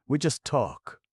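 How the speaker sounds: high, loud dry dig; acid jab. A narrator's reading. Pat mocking the men's idle talk, acid. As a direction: finish the sneer crisply. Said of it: "put in Pat acidly".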